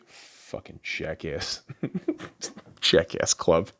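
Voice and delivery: soft, slow, breathy under-breath jab; a slow insult under the breath; muttered insult. Real, not acted. Muttering about the rival club under his breath.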